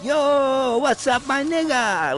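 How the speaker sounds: high, drawn-out greeting in a mock Japanese accent. Little boy walks in on dad washing dishes, high mock Japanese accent. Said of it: "with the Japanese accent".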